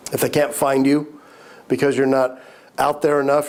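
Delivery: ordinary talk, nothing marked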